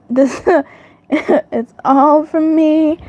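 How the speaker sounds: laughing at her own joke, slowly. Real, not acted. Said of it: "I crack myself up".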